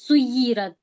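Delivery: سیرت with the doubled ya pressed, not rushed; careful stressed reading. Shown as a demonstration. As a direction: hold the doubled ya in سیرت firmly. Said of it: "اس طرح پڑھنا ہے".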